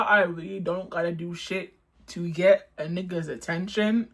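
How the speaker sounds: ordinary delivery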